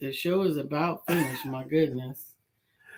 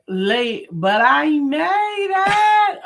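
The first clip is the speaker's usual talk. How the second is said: reading comment aloud; 12 semitones higher, 11 dB louder, much slower than usual. Retold or imitated.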